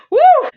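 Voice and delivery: loud, high whoop; excited woo. Real, not acted. Reacting to the drama for viewers with a loud high woo. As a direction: let out a big woo. Excited exclamation after laugh.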